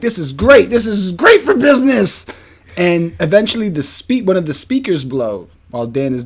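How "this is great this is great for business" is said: loud, high, thrilled self-quote; hyped-up "this is great"; excited. Retold or imitated. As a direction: cheer it like a young owner seeing profit. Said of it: "and I'm like".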